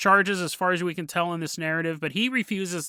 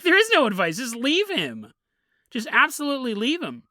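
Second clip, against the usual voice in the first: emphatic advice; 6 semitones higher than usual. Retold or imitated. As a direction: tell her flatly to leave. Commenters' blunt advice.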